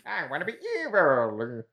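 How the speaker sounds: high sneering cartoon villain; Skeletor voice. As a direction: ham up the eighties Skeletor. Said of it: "that old eighties Skeletor".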